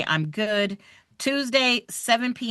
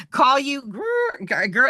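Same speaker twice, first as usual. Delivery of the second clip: laughing; 5 semitones higher, 3 dB louder, much slower than usual. Real, not acted. Laughing while reading chat.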